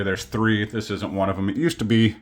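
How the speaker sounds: usual speaking voice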